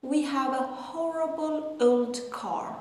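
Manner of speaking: slow careful reading of the sentence; slow model sentence. Shown as a demonstration. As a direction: read it slowly for learners. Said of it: "model sentence". (خواندن آهسته و شمرده)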